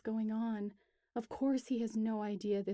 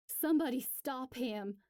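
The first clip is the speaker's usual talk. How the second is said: a raised, higher plea for help; desperate cry; 4 semitones higher, 4 dB louder than usual. Acted. Clover watching Jonah smash the dam, crying out. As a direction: cry out for someone to stop him. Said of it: "cries Clover".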